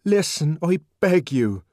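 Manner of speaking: high, loud plea; earnest cry. A narrator's reading. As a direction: beg the crowd to listen. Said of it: "cried the savage earnestly".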